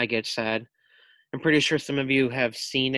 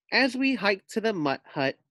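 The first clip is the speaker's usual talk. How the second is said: plain reading voice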